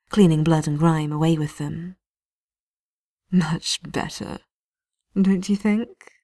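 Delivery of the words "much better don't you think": slow, sugary menace; sweet as poison, taking his time; sweetly. Acted. Knight captain gloating over the wounded prisoner, sweetly. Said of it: "he says sweetly".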